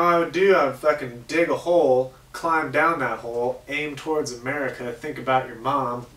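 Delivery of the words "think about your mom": ordinary delivery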